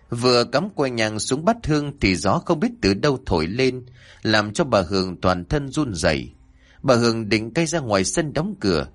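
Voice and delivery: usual speaking voice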